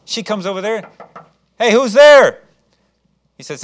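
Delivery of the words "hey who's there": calling out. Acted. Rhoda answering the knock.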